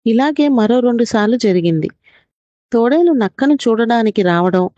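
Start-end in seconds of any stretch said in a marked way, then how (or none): none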